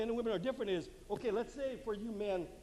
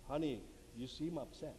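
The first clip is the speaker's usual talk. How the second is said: soft, lowered voice to a spouse; gentle validation; 5 semitones lower, 8 dB quieter than usual. Shown as a demonstration. Husband to his angry or upset wife, gentle validation. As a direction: soothe with a hushed, lower tone.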